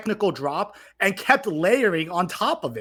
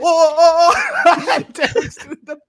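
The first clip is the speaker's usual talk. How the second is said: laughing; 11 semitones higher, 8 dB louder, much slower than usual. Real, not acted. Laughing after singing.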